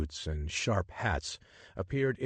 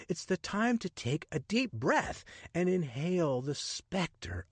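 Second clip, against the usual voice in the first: higher, sweeping, wonder-filled pitch; enthusiastic adventurer; 10 semitones higher than usual. Acted. Richard urging the woman to put her book down, rapturous. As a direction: sell her the magic of Egypt.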